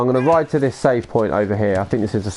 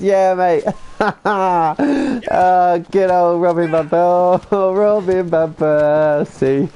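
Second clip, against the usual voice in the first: higher, slow words broken by real laughter; can barely get the name out for laughing; 7 semitones higher, 4 dB louder, much slower than usual. Real, not acted. Laughing at chat joke.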